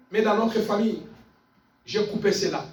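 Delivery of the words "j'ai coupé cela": ordinary delivery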